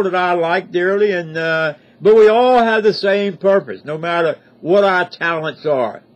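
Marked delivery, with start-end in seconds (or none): none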